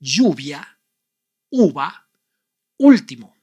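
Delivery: lluvia, uva, último in careful Spanish; Spanish words. Shown as a demonstration. Teacher giving the right Spanish pronunciations. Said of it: "The right pronunciations for these three words".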